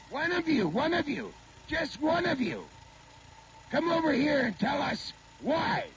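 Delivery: high, strained demand for an answer; confrontational calling out. Real, not acted. Protester challenging them to come over and tell why, high shouted demand. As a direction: call them out, pitched high and insistent.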